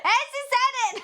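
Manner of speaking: high loud laughing exclamation; laughing delight. Real, not acted. Streamer laughing that the game said it. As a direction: crack up in disbelief.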